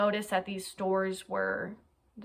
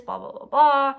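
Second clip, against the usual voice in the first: louder, higher mocking blah blah; dismissive blah blah; 5 semitones higher, 10 dB louder than usual. Narrator mocking the killer's long alibi statement, dismissive. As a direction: brush his excuses off as noise. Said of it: "he wrote a twenty-five minute".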